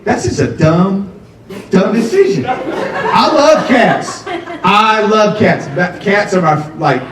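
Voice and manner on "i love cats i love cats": very slow, stretched out with a laugh; mock-earnest insistence. To the audience defending cats, very slow. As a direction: drag it out, overselling.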